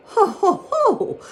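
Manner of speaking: very high, loud hooting "ho ho ho"; high-pitched crowing shout; shouted mocking laugh. A narrator's reading. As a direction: hoot it out like a gleeful rabbit. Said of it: "shouted Peter Rabbit".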